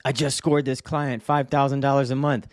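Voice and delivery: lightly mocked eager novice; imitating a naive kid. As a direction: play the clueless kid bragging, then asking. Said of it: "a kid in a group saying".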